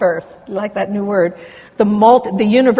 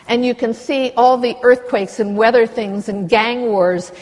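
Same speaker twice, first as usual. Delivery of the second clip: normal talking voice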